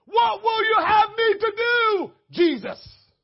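high, loud, slow shouted question; Paul crying out. Retold or imitated. Paul answering Jesus on the road, shouted plea. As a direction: call out like Paul did. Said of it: "that's what he said".

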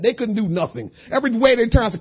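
ordinary delivery

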